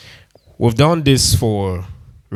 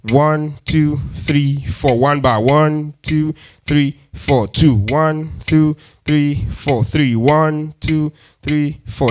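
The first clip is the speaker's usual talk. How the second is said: counting the bars; 4 semitones higher, much slower than usual. Shown as a demonstration. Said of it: "Let's do it again".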